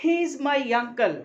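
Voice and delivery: HE IS MY UNCLE said loud and slow; emphasised whole sentence. Shown as a demonstration. Teacher demonstrates sentence stress to students, all emphasised. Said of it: "ये मैंने emphasise करके बोला".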